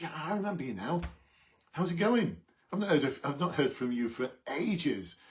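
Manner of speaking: bright, loud, high recognition; excited greeting. Retold or imitated. Officer greeting the man who flagged him down, excited and loud. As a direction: greet an old face with delight. Greeting a former offender warmly.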